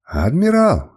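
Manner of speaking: high shout of Адмирал!; distant shout. A narrator's reading. A follower calling after the leaving admiral, shouting. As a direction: cry Адмирал! from a crowd across the hall. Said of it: "доносились до меня чьи-то крики".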